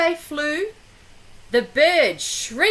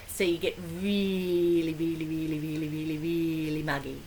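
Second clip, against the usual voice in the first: much lower and softer, fast; soft explaining; 9 semitones lower, 7 dB quieter, faster than usual. Real, not acted. Reader explaining a bog to the child, low and soft. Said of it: "explaining the bog to child".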